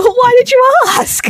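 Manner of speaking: loud, high mock outburst; exasperated comeback. Acting out reply to someone who ignored her advice, loud exasperation. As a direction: throw it back, exasperated and shrieky. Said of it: "And you're like".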